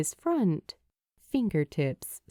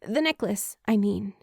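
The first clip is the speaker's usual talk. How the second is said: higher and fast, flustered backpedal; hasty stammer; 6 semitones higher than usual. Acted. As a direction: rush it out, tripping over the words. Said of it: "she stammers a bit when she hastily adds".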